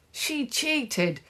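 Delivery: put-on high-pitched child's accusation; screeched. A narrator's reading. Henry accusing his rival, high screech. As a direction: screech it high, without much extra volume. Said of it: "screeched Henry".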